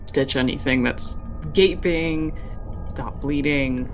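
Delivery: usual speaking voice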